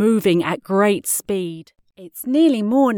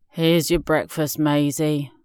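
lower, weary dad voice; groaning dad; 5 semitones lower than usual. A narrator's reading. Dad serves Maisie her breakfast, low and weary. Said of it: "Dad groaned".

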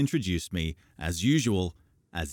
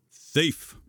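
higher, put-on sternness; mock stern; 9 semitones higher, 4 dB louder than usual. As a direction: pretend to be strict. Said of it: "trying to sound stern".

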